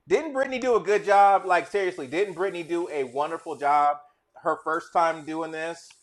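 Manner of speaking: loud, high hype; enthusiastic praise. Real, not acted. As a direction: hype her up loudly. Praising guest to audience.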